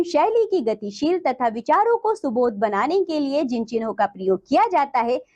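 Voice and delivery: ordinary delivery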